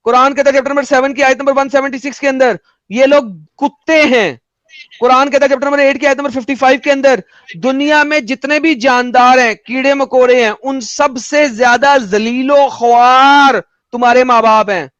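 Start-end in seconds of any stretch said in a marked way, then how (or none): 2.9-4.4 slow, drawn-out pronouncement; declaimed quote. Acted. Quoting scripture at opponent, emphatically. 7.6-14.9 slow build with stretched words; declaimed quote. Acted. Quoting scripture at opponent, emphatically.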